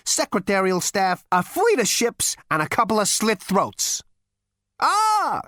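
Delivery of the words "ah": put-on high, satisfied old ghost; a knowing old ghost's "Ah". A narrator's reading. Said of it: "nodded the ghost in satisfaction".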